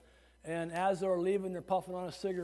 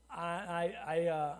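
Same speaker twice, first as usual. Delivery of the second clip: ordinary talk, nothing marked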